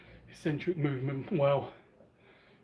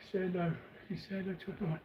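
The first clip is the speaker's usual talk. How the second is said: talking to a dog; 4 semitones higher, 7 dB quieter, much faster than usual. Real, not acted. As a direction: gently praise the pet.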